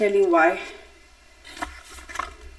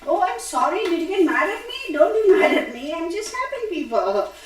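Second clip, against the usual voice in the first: mock apology; 9 semitones higher than usual. Playful apology to a viewer.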